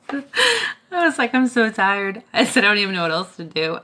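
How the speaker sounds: loud, high mock wail; retold tearful exhaustion. Retelling crying to husband, mock tearful. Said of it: "I started crying the other day".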